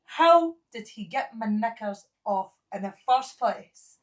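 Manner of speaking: ordinary talk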